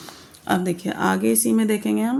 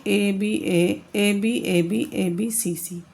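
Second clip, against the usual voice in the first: slow rhyme letters; much slower than usual. Shown as a demonstration. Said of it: "rhyme scheme क्या होती है".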